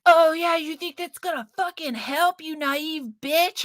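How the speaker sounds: high, loud sneering internet troll voice, slowing on the insult; mocking troll. Commenter mocking her post in a high sneer. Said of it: "people were like".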